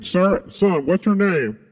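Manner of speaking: high, loud call across the room. Real, not acted. Calling out to the sound guy, loud and high. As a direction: shout for the board guy to answer.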